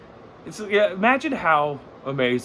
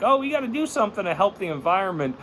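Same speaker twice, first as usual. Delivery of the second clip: mock whiny activist; 8 semitones higher, faster than usual. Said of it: "complain about, like".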